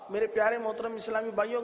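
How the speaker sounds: ordinary talk, nothing marked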